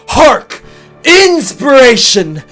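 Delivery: loud, high proclamation; exultant cry. Acted. Sandwich maker struck by inspiration, exultant cry. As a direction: cry it out like a revelation.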